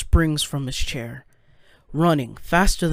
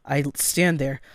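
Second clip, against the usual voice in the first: plain reading voice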